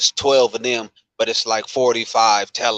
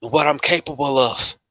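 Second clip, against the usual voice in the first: imitating a furious rant; 6 semitones higher than usual. Coworker retelling a vet's outburst at managers, building to an imitated furious yell. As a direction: mimic a vet who finally snaps at his managers. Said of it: "But he's like".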